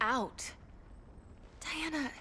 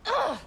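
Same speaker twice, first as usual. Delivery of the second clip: frustrated grunt; 9 semitones higher, 6 dB louder than usual. Acted. Frustrated Kara at being confined.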